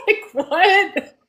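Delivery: high laugh spilling into the words. Real, not acted. Host laughing in disbelief at the child's message. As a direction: laugh through a stunned "what".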